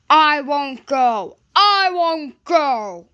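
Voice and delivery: slow, loud sulky refusal; stubborn grumble. A narrator's reading. Gordon refusing to go to the turntable, slow loud sulk. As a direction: dig in and refuse like a sulky child. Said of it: "grumbled Gordon".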